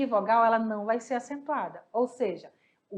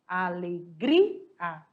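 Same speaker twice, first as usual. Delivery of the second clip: Alegria very slow, split so that gri stands out, falling at the end; stress on GRI; 5 semitones lower, much slower than usual. Shown as a demonstration. Teacher slowly breaking Alegria apart to show the students its stressed gri. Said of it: "não é ali, e sim aqui".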